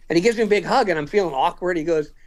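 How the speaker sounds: ordinary delivery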